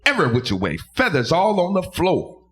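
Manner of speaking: ordinary delivery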